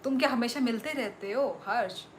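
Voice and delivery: ordinary talk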